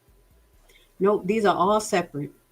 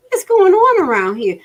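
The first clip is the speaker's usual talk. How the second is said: much higher and louder, demanding; exasperated outburst; 11 semitones higher, 7 dB louder than usual. Real, not acted. Vlogger outraged at the budget numbers, loud high demand. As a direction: throw the question at the whole city.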